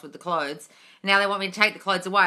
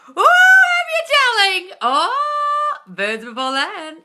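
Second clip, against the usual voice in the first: much higher and louder, swooning over the news; gushing delight; 11 dB louder, much slower than usual. Real, not acted. Streamer coos at a viewer's dating news, gushing. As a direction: coo it like a thrilled best friend.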